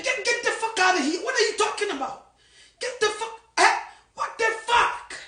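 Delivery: high mocking yell of scorn; angry dismissal. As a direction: brush him off, outraged. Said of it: "The odi na wa ye".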